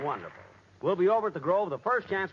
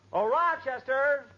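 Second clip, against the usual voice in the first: long loud drawn-out call to the next room; 10 semitones higher, 6 dB louder, slower than usual. Acted. Host calls his butler from the fireplace, loud drawn-out call. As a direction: stretch the name out and call it across the house.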